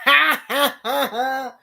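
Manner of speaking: high taunting ha ha; hammed-up mocking laughter. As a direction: cackle like a smug villain.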